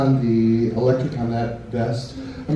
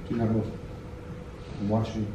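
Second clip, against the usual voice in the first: quiet menace; 5 semitones lower, 13 dB quieter, much faster than usual. Acted. Menacing the volunteer.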